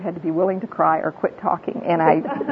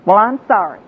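put-on louder grudging kid's sorry; mock child's forced apology; child's insincere apology; 3 semitones higher, 5 dB louder than usual. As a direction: apologize with no remorse, imitating a child. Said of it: "Somehow it lacks that, uh, feeling".

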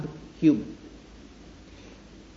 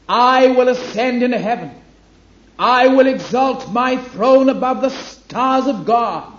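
proud, soaring, loud boasting of Lucifer, much higher; Lucifer's boast; 7 semitones higher, 7 dB louder than usual. Acted. Preacher voicing Satan's boasts to the congregation, loud and proud. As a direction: declaim each I will like an arrogant rebel.

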